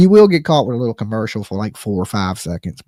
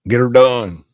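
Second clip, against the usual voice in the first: louder, a brash cowboy drawl; 6 dB louder than usual. Shown as a demonstration. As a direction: belt it like a rodeo cowboy. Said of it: "In my best cowboy voice".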